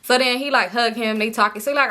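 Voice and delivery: plain reading voice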